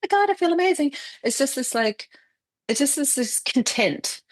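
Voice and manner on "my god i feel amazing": much higher, gushing oh my God; hammed-up sudden high; mock euphoric. Describing a rush she doesn't get, mock gushing.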